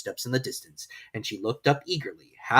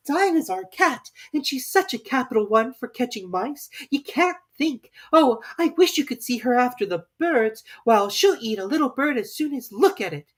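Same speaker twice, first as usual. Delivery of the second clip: put-on higher, louder eager girl; 12 semitones higher, 5 dB louder than usual. Alice eagerly praising her cat, higher. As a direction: gush about her pet. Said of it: "Alice replied eagerly".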